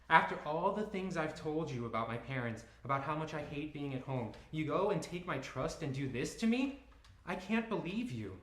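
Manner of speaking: high, loud, hurt accusation; angry and betrayed. Acted. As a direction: let the betrayal pour out. I'm so angry.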